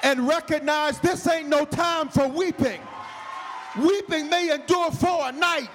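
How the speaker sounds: high, loud, slow shouted preaching; loud, high, slow preaching to the whole church; full-force preaching. Acted. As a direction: preach it out to the back row.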